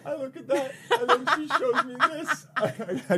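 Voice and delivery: high laughing voice. Real, not acted. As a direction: laugh while telling it. Laughing retelling.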